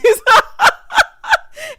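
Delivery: loud, high burst of laughter; real high-pitched cackling laugh; big laugh. Real, not acted. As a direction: crack up hard at your own joke. Laughs at her own joke.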